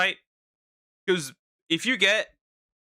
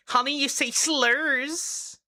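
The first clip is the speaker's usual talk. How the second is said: mocking impression; 8 semitones higher, slower than usual. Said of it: "it just get".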